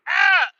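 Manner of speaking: high, loud mock yelp of surprise; startled high cry of a kid crashing; retold scream. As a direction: yelp like you just hit the dog. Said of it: "I go".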